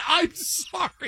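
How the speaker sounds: high, drawn-out mock apology; mocking retort. Host parrots his partner's apology back, mocking. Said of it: "And you said".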